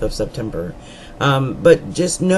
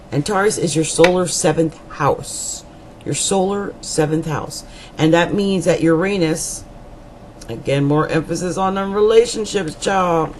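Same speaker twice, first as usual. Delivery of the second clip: usual speaking voice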